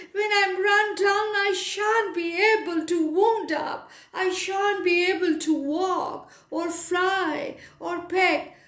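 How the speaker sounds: the bird wailing over his lost key; loud wailing. Acted. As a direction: wail like a wound-down clockwork bird. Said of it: "broke into loud wailing".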